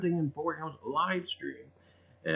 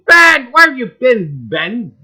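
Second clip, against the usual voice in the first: loud, higher playful call across the room; 8 semitones higher, 12 dB louder than usual. Real, not acted. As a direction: hail a friend who's been away. Calling out to viewer Ben.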